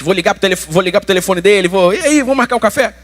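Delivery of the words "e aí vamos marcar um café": higher, chirpy phone-call greeting; mock-friendly invitation. Pastor mimicking a call to the offender, mock-friendly. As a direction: pitch it up like a chummy caller.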